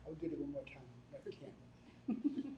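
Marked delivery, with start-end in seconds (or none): 1.1-2.6 laughing high, slow no; giggling no, you can't. Real, not acted. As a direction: laugh it out while refusing. Laughing refusal to redo.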